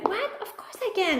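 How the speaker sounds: put-on proud child taking the dare; a hint of a small boy; eager little boy. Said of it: "he's like".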